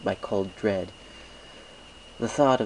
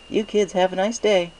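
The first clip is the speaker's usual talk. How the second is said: high, loud cheery baker voice; cheerful baker; 11 semitones higher, 6 dB louder than usual. Acted. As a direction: call out the offer like a jolly shopkeeper. Mister Cake says goodbye.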